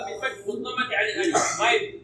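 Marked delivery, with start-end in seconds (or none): none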